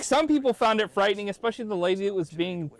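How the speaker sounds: high, loud enthusiastic voice; excited. Retold or imitated. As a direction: rave about it with glee. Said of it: "he's like".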